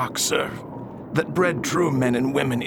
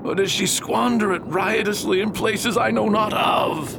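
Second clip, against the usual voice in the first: high shouted accusation; angry outburst; 4 semitones higher than usual. Acted. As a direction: rant at the absent girl. Father rants about daughter.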